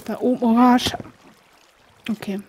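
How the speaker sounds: usual speaking voice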